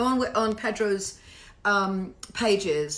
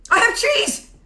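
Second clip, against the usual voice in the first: high, loud proclamation; hammed-up, piercing announcement; triumphant shout; 13 semitones higher, 8 dB louder, slower than usual. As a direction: shout it out like huge news. Triumphant announcement about cheese.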